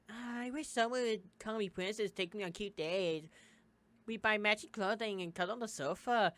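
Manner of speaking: put-on lightly squeaky dreamy girl; mock-swooning squeaky imitation; squeaky girly voice. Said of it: "don't use that squeaky voice".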